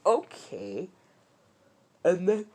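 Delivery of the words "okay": mildly stunned, rising okay; dumbfounded. Retold or imitated. Deku hearing the system's answer, kinda dumbfounded. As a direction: take it in, a touch lost. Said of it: "Deku's kinda dumbfounded".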